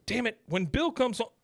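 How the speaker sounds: high-pitched, frustrated outburst; exasperated Hank. Retold or imitated. Retelling Hank's threat to the trespasser, exasperated. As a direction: act out an indignant protest, pitch jumping. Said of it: "the guy starts making fun of Hank".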